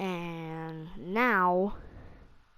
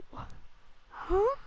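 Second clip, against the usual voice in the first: surprised; 14 semitones higher than usual. Retold or imitated. Teacher reacts in disbelief.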